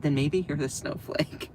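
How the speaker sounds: normal talking voice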